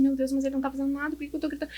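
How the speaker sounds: hushed, hurried thought to self; softly acted self-questioning; retold realization. Retold startled thought to herself.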